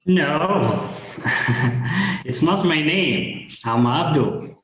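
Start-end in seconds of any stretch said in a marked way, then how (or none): none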